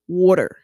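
"water" with a Baltimore vowel and a full R; Baltimore-style "water", R sounded at the end; Baltimore accent. Shown as a demonstration. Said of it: "will say water".

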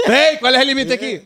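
mock indignant challenge. Passenger challenges driver over the speed limit, mock indignant challenge. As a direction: challenge loudly in a hammed-up voice.

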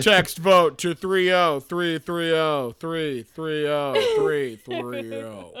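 slow, stiff robot repeat; glitching robot. Shown as a demonstration. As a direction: imitate a broken robot, slowly. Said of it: "You sounded like a broken robot".

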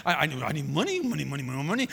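hammed-up, repetitive fretting over money; mock money fretting. Mimicking anxious fretting over money.

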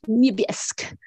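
put-on Niebiesk in a playful French accent, ending cut off; imitated French accent. Mother imitating her young son's joke word, French accent. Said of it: "he'll say it in a French accent".

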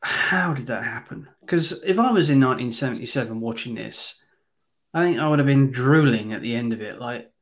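ordinary talk, nothing marked

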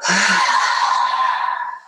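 audible breath out through the mouth; long breathy exhale. Shown as a demonstration. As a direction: release the breath with a sigh. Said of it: "let it out through your mouth".

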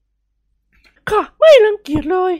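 very high, slow, loud falsetto; smiling matron. Acted. Lady reassuring her eager guest, smiling falsetto. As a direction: agree sweetly with a smile. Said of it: "หล่อนพูดยิ้มยิ้ม".

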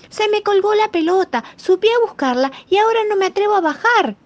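high, loud cry from a rooftop; shouting for help. Acted. The little piglet yelling from the roof for help. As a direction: yell down, stuck and scared. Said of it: "gritaba el pequeño chanchito".

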